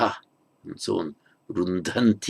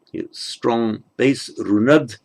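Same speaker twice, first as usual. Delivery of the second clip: ordinary talk, nothing marked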